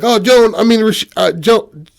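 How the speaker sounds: high, loud, stumbling call after her; flustered backtracking. Retold or imitated. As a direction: act out fumbling for her name. He fumbles, calling her back.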